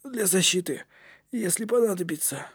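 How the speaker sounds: put-on soft, high boy's voice; small, worn-out character voice; weak Knut. A narrator's reading. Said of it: "произнёс Кнут".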